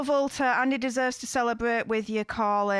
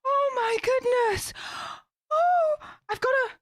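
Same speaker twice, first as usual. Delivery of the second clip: still overwhelmed; 10 semitones higher than usual. Real, not acted. Still overwhelmed after dancing.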